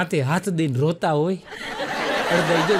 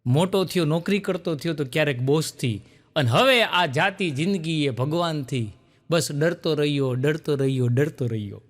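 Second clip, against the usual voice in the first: poem recital; 4 semitones lower than usual. Retold or imitated. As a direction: recite it dying away. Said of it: "ડર ઉપર કવિતા સંભળાવતા".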